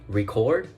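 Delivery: record with cord lifted, rising; stress on cord. Shown as a demonstration. Student demonstrating for class: record, stress on cord. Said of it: "a stress in the syllable cord".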